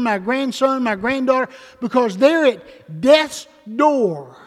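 loud, emphatic pulpit delivery; full-force preaching. Real, not acted. Preacher imagines pleading for his own children, full-force preaching. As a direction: drive it home to the congregation, loudly.

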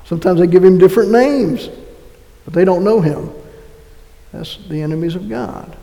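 normal talking voice

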